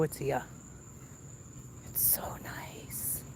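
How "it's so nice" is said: hushed whisper so no one hears. Real, not acted. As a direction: whisper it secretly. Whispering about neighbor's yard.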